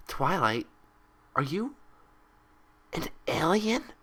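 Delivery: high, hushed wonder; awed Pinkie. A narrator's reading. Pinkie asking Twilight in awe, high and hushed. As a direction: ask as if the answer is thrilling.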